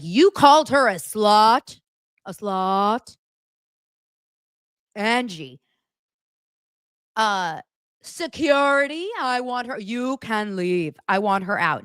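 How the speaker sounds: loud, outraged put-on voice, slow calls for security; Meredith impression. Meredith ordering Angie out of her party, hammed-up outrage. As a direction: play the offended hostess throwing a guest out. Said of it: "Meredith is like".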